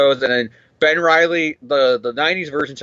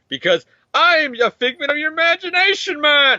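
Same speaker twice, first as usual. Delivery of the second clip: much higher put-on dude voice; nineties Scarlet Spider voice; 12 semitones higher than usual. Voicing the imaginary Scarlet Spider teasing Ben, high dude voice. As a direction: play the smug imaginary nineties hero. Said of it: "A K A nineties Scarlet Spider".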